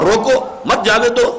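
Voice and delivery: high-pitched روکو, break, then مت جانے دو as one; pause after روکو. Shown as a demonstration. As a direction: split off روکو, keep مت with جانے دو. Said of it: "یہ ہے اصل شے".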